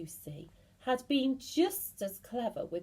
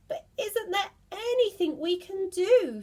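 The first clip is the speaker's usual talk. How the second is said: much higher, louder plea; desperate plea; 11 semitones higher, 6 dB louder than usual. Acted. Mrs Twit desperately pleading with her husband. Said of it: "said Missus Twit".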